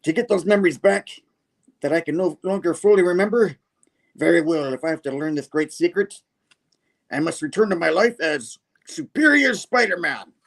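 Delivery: high, grandiose supervillain speech; villain's vow. Acted. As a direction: build to a hammed-up declaration. Villain declares his plan.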